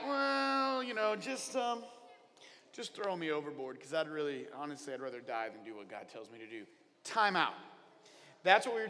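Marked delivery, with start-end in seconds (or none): 0.1-6.7 low, quieter grumbling Jonah voice; sulky Jonah. Acted. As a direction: Jonah shrugging off blame, mumbled low. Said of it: "And he's like".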